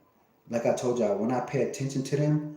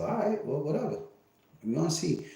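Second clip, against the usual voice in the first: much higher, breezy dismissive thought; mock inner voice; 7 semitones higher than usual. Thinking to himself about the threat, high and dismissive. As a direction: shrug it off in a lifted voice. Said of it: "I'm thinking to myself".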